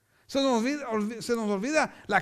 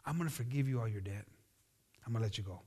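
gentle; 11 semitones lower, 10 dB quieter, faster than usual. Retold or imitated. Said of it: "He says".

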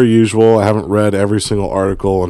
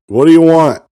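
loud, high counter-man yell; mock yell; 8 semitones higher, 8 dB louder than usual. Counter guys yelling at a customer, mock yell. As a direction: yell it impatiently, imitating diner staff. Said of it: "they always just yelled at me".

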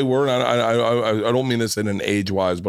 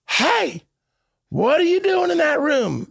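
much higher, mock yell at her husband; wife yelling; slower than usual. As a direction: holler like an angry wife from the next room. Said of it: "the wife's yelling".

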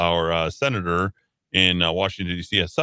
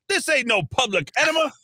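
much higher mock shout of the film line; quoting a movie line. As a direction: bust into the party hollering it. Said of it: "He's like".

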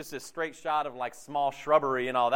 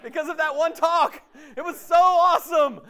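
higher, louder mock-hyped vow after a conference; emotionally hyped; 12 semitones higher, 8 dB louder than usual. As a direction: belt it out like a pumped-up retreat kid. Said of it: "when you get all emotionally hyped".

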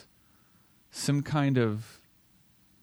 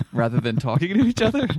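laughing through the words, higher and louder; 6 semitones higher, 5 dB louder, faster than usual. Real, not acted. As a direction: say it while laughing. Jokes about technology giving depth.

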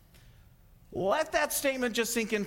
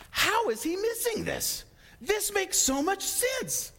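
high, slow, incredulous; amazed disbelief; 10 semitones higher than usual. Retold or imitated. A believer marvelling at an atheist missing the sense, high and amazed. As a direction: marvel that anyone could miss it.

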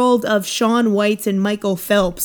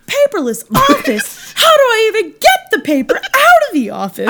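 Jerry Seinfeld impression; 11 semitones higher than usual. Second, fuller go at the Seinfeld voice. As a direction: go big with the stand-up bit. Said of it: "You don't have to do paperless office".

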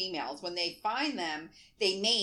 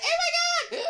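high, laughing mock scream; mock shriek; 6 dB louder, slower than usual. Retelling seeing a spider at home, a laughing high mock shriek. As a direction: shriek like a spider just jumped you. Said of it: "every time I'm like".

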